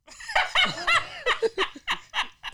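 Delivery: high-pitched burst of laughter. Real, not acted. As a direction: cackle high and long. Laughing at friend.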